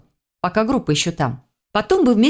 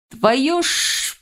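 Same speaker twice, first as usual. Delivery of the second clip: higher, hissing druid; hissed curse; 7 semitones higher than usual. A narrator's reading. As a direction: hiss out a cut-short oath. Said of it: "зашипел друид".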